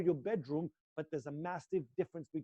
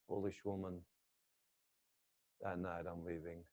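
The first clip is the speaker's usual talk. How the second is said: much lower and softer, almost to himself; low murmured vow; 10 semitones lower, 10 dB quieter than usual. Shown as a demonstration. Son muttering at grandma as he leaves, low and soft. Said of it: "continues the vow".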